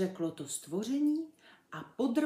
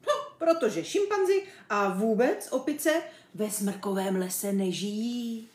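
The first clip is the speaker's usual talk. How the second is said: lightly voiced chimp, emphatic explanation; animated animal stating the obvious; exasperated chimp; 3 semitones higher, 7 dB louder than usual. A narrator's reading. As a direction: spell out the obvious, slowing at the end. Chimp character explains impatiently.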